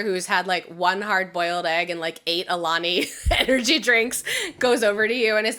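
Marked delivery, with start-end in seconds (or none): none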